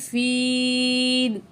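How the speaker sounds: drawn-out Quranic recitation; chanted tajweed reading; slow recitation. Shown as a demonstration. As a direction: hold the long vowels fully.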